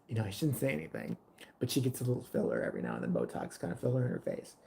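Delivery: low, hushed, fast gossip; hushed mock gossip; gossipy whisper. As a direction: lean in and dish the secret. Waitress gossiping confidentially.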